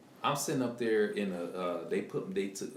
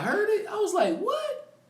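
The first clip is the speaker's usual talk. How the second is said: high, loud startled "What?"; surprised shout; 5 dB louder, slower than usual. Real, not acted. Patient hearing Macedonian behind the curtain, shocked shout. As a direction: yelp in disbelief at what he heard.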